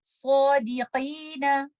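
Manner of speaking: Sadiqina recited slowly with heavy sad and qaf; heavy recitation. Shown as a demonstration. Teacher recites Sadiqina for students, slowly. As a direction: draw out Sadiqina with full tafkhim. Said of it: "recite it like this".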